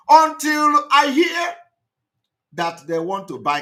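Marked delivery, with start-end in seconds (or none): 0.1-1.5 higher, louder and slower outburst; outraged outburst. Real, not acted. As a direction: burst out in disbelief. Outraged rant.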